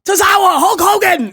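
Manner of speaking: high-pitched mock hype yelling; mock screaming. Host mimics guest host yelling names into a live mic, mock screaming. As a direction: imitate a celebrity screaming names at the crowd. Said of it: "She just started screaming".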